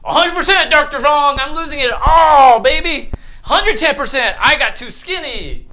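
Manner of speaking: mock overeager answer. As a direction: ham up a patient's wild overconfidence. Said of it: "What do you think it is?".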